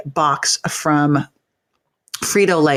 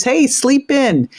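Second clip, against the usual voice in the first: coaxing; 9 semitones higher, much slower than usual. Shown as a demonstration. Relaxed weekend advice, coaxing.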